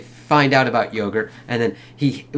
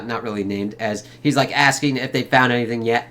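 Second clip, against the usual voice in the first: usual speaking voice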